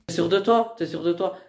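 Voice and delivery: normal talking voice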